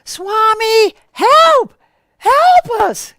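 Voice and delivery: high, loud desperate scream; panicked scream for Swami to help. Retold or imitated. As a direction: shriek it out in terror. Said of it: "Anita screamed out".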